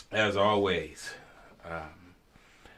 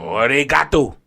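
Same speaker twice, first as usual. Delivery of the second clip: mock Japanese; 6 semitones higher, 10 dB louder than usual. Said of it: "you see how I just hit y'all with that".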